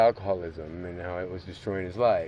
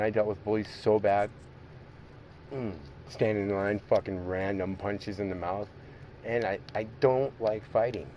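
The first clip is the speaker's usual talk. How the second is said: ordinary talk, nothing marked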